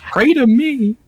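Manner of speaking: put-on loud, high Irish-accented command. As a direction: boom it out as an Irish god. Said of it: "I lost my Irish accent there".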